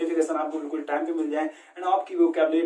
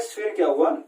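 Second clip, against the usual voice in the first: ordinary talk, nothing marked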